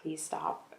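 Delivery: quietly recalled plea; not loud enough. Retold or imitated. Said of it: "not loud enough for the people in the house to hear".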